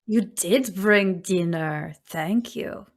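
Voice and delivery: slow, lightly voiced crone, satisfied; pleased old witch. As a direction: savour the gift like a hungry hag. GM voices Baba Yaga, pleased.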